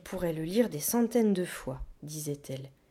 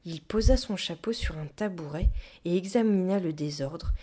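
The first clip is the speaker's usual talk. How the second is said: ordinary delivery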